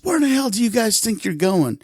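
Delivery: high-pitched, indignant demand; retold angry challenge; angry confrontation. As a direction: confront them, pitched well up. Angry father confronts the boys.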